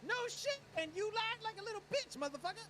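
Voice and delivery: loud, high furious yelling at a liar; angry in-character shout. Acted. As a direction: blow up at him, loud and high. Accusing a friend of lying.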